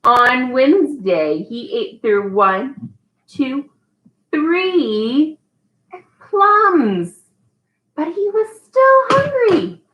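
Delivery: slow, drawn-out storybook reading; patient read-aloud for a toddler; reading to a child. Acted. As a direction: leave pauses for the child to fill in. Reads The Very Hungry Caterpillar.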